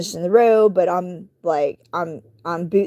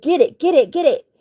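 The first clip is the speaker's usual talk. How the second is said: urgent urging; 7 semitones higher than usual. Retold or imitated. Urging other driver to catch kitten.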